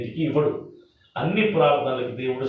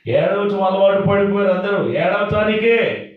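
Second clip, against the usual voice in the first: loud, high exclamation to the congregation; exasperated outburst; 5 semitones higher, 4 dB louder than usual. Real, not acted. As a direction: raise the voice sharply in rebuke. Preacher exclaims at habitual weepers. (గట్టిగా, హెచ్చు స్వరంతో మందలింపు)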